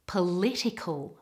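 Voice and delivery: "Political" with all the stress on the second syllable. Shown as a demonstration. Teacher models it after "Ready?", second-syllable stress on "Political". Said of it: "stress should all be on the second syllable".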